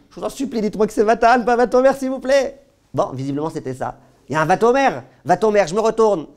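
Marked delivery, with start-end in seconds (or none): 0.1-2.6 high, loud, hammed-up begging; mock pleading. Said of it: "pleads with the girls, mock". 4.3-6.3 Vatomer pushed loud and higher; emphatic Vatomer. Retold or imitated. Reenacts reading with emphasis.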